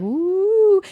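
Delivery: a high, loud, drawn-out mystical woo; healer's mystical woo. Retold or imitated. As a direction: play the hand-waving energy healer. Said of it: "they're like".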